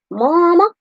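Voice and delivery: high drawn-out Southern "Mama"; Southern twang. Retold or imitated. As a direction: imitate a Southern son calling mom. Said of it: "'cause he's from the South".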